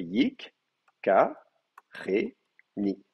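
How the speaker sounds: "yik, ka, re, ni" with a gap after each syllable. Shown as a demonstration. Teacher dividing the word for students, "yik, ka, re, ni" spaced out. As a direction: chop "yik, ka, re, ni" into four separate beats. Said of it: "votre division syllabe".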